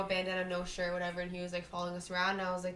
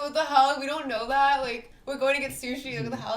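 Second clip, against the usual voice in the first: mock-indignant protest, higher and louder; indignant retort; 4 semitones higher, 8 dB louder than usual. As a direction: protest in disbelief, retelling your own comeback. Retelling indignant reply to police.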